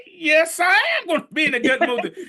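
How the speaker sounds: high, loud, insistent comeback; defiant self-affirmation. Acted. Man answers his bad-mood voice, loudly defiant. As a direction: shout down your own doubt. Shouts back at negative inner voice.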